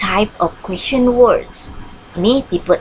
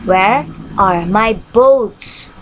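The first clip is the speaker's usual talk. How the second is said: Where are my boots, each word drawn out slowly; slow model sentence; much slower than usual. Shown as a demonstration. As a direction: read the example slowly for learners. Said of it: "contoh-contohnya". (contoh dibaca pelan)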